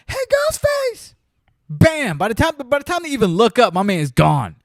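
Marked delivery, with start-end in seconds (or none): 0.1-1.1 mock call to Ghostface. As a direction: sing out his name like a clueless victim. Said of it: "they go".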